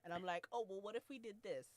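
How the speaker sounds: usual speaking voice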